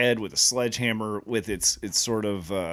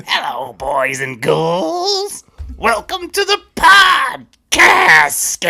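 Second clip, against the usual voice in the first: high, loud, slow shrieking ghoul host; Crypt Keeper voice; 12 semitones higher, 11 dB louder, much slower than usual. Pretend. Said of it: "That's pretty good".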